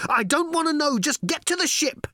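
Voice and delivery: high, loud panicked shout. Acted. Ford yelling to Arthur, loud panicked shout. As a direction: yell it as you bolt. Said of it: "shouted Ford".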